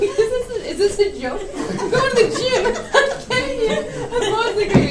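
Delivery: high, loud protest breaking into laughter; laughing disbelief. Retold or imitated. Daughter retelling her protest at Dad's donut order, laughing incredulously. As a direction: crack up while objecting incredulously.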